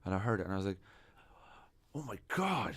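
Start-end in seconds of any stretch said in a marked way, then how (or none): 1.9-2.8 higher-pitched awestruck reaction; mildly amazed, reliving it; retold amazement. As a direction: relive hearing a great discovery. Retelling his amazed first reaction.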